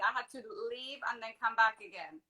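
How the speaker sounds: usual speaking voice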